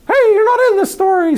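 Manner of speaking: high, loud hen scolding. Acted. Chicken telling the narrator he is not in the story, high and loud. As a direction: squawk at the narrator.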